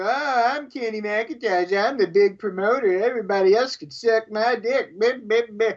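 mocking impression with the co-host's accent put on; Kenny McIntosh. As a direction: mimic your co-host's accent as a boastful promoter. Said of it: "that's your accent, too ... good impression of you".

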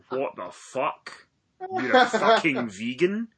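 slow, stunned disbelief rising at the end. Retold or imitated. Retelling his reaction to a friend turning vegan, stunned disbelief. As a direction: drag it out, can't believe it.

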